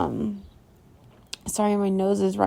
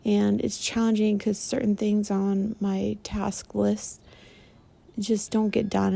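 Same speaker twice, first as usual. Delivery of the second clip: ordinary talk, nothing marked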